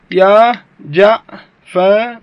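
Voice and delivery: very slow جعفر split as "ja-fa-ru"; full form, syllable by syllable. Shown as a demonstration. Teacher showing students the original word, جعفر broken slowly into "ja-fa-ru". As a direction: sound out جعفر in separate drawn-out pieces, ending "-ru". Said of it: "هذا أصلها يا جعفر".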